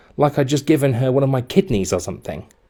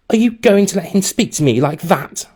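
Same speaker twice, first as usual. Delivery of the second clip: put-on higher, louder indignant girl; fuming demand; 9 semitones higher, 4 dB louder than usual. A narrator's reading. Girlfriend fuming at her boyfriend, indignant. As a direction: demand he defend her, outraged. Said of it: "She was fuming".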